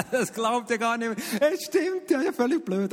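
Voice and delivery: laughing it off high. Real, not acted. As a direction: chuckle through the words. Laughing off worry.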